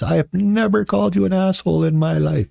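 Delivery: high, sustained mock-indignant wife voice; hammed-up wifely denial; imitating wife. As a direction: protest high on one held pitch. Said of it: "And she says".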